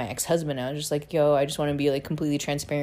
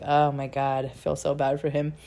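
ordinary talk, nothing marked

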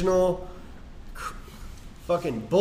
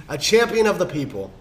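normal talking voice

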